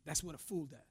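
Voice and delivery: ordinary delivery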